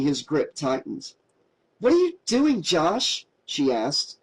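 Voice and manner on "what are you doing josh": startled, sharply higher question; surprised. A narrator's reading. Mary startled as drunk Josh grips her wrist, higher alarmed question. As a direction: jolt up in alarm on the first words. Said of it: "surprised by the aggressive behavior".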